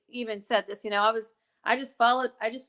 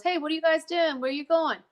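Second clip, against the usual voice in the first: retold yell; 5 semitones higher than usual. Property owner calling to girls on her land, mild retold yell. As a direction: call it out as an imitated, half-hearted yell. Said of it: "I yelled at the girls".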